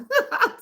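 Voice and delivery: short high-pitched laugh; amused laugh. Real, not acted. Laughing after telling how she left the boss, amused. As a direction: laugh at your own story.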